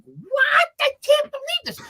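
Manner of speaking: very high, loud shout of disbelief; outraged cry. Retold or imitated. Teen assistant sent on an errand, crying out in outrage. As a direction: wail it like the world is unfair. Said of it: "And I'm like".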